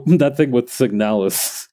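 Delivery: ordinary talk, nothing marked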